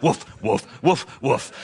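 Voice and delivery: playful woof woof, lightly barked; mock dog barking. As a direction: bark like a dog to tease the idea. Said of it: "lead the dog in barking".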